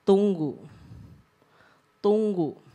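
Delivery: brief, unhurried, measured command; lightly voiced word from within, set apart by long pauses; inner voice of God. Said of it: "ada suara di hati saya bilang gini".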